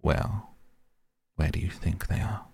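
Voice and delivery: dry, softly spoken cat; understated and dry, a little softened. A narrator's reading. Cat answering Caroline in her imagination, dryly. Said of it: "dry as a dead fly".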